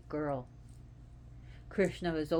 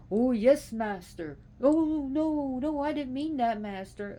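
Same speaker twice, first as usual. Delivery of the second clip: high, loud, fawning servant voice; mock servant; 7 semitones higher, 6 dB louder than usual. Mock servant grovelling to his master, high and fawning. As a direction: grovel to your master, overdone.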